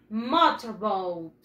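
MOTORBOAT slow, "mo-to-bout", falling low at the end; pronunciation model. Shown as a demonstration. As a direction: say MOTORBOAT drawn out for a class to repeat, pitch dropping.

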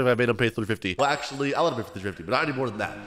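low, soft muttered reckoning; hushed, low-pitched aside to self; private aside. Real, not acted. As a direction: think it over under your breath. Private aside about the price.

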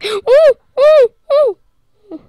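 high, loud excited oohs; excited squeals. Real, not acted. Excited at something in game.

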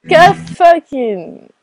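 ordinary talk, nothing marked

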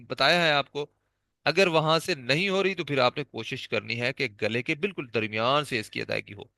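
usual speaking voice

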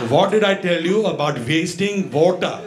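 put-on measured, Indian-accented telling-off; strict interrogation, Indian accent; stern Indian grandpa. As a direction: scold like an old-school Indian grandpa. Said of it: "he used to talk to us like this".

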